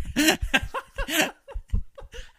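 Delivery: shrieking burst of laughter; a high, loud laughing fit. Real, not acted.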